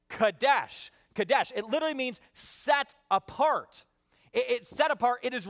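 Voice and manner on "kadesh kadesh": "Kadesh" said kuh-DESH, stress on the end; Hebrew word. Shown as a demonstration. Pastor teaching the Hebrew word "Kadesh", said kuh-DESH. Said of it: "You would say that with me?".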